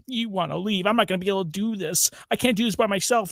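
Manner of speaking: mock a helpless wife pleading; high whimpering parody voice; whiny mock wife. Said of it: "she's just like".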